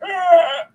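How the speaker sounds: loud, high startled cry; retold gasp. Host reliving her surprise while driving, a startled cry. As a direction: yelp like the view just struck you. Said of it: "I'm just like".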